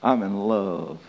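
low, soft confiding admission; imitating a friend's hushed confession; retold love confession. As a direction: confess it quietly, drawing out love. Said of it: "And she said, "[name], I just gotta tell you".

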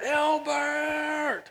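loud, very high, stretched-out call; imitated child's holler. Boy keeps calling the neighbor to stop, imitated holler. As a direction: keep hollering the name like a little boy. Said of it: "he just kept hollering".

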